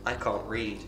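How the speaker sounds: normal talking voice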